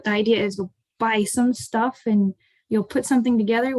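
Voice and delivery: ordinary talk, nothing marked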